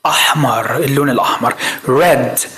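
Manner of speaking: ordinary delivery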